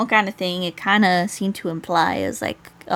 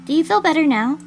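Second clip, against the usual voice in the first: higher, louder concerned question; lightly voiced visitor; 7 semitones higher, 5 dB louder than usual. Visitor asking the patient in hospital, lightly voiced concern.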